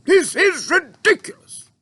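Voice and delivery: high, loud indignant officer; cried out. A narrator's reading. Air Marshal protesting to the Queen, loud high cry. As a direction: protest in outrage. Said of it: "cried the Air Marshal".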